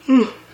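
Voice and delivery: big tired yawn out loud. Real, not acted. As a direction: yawn it out, sleepy. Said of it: "forgive me for that yawn".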